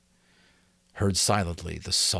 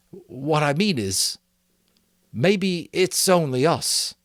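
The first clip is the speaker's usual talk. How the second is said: higher and slow, groping for words; hesitant Simon; 8 semitones higher, 4 dB louder, slower than usual. A narrator's reading. Simon to the assembly, slow and hesitant. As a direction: say it carefully, unsure.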